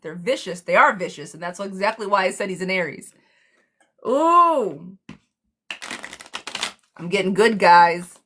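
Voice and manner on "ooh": high, loud thrilled "Ooh"; a delighted, high-pitched ooh; excited ooh. Real, not acted. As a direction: squeal it with real excitement. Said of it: "I get excited".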